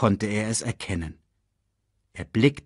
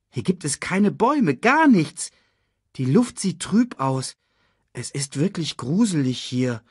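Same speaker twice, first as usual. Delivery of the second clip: put-on uneasy young Nala; 7 semitones higher, 3 dB louder than usual. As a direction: look around with a touch of unease. Nala describes the eerie place.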